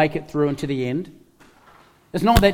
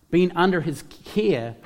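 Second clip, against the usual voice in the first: ordinary delivery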